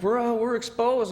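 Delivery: high, urgent warning about the danger. Retold or imitated. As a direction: push the alarm up high. Under fire, urging cover.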